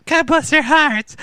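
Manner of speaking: high mock Wisconsin accent on God bless their hearts; northern Wisconsin accent. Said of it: "They sounded like Canadians".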